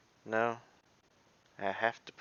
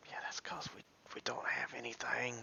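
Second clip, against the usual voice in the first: soft unvoiced whisper; hushed whisper to yourself; 3 semitones higher, 8 dB quieter than usual. Real, not acted. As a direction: breathe the words as a private aside. Muttering aside while playing.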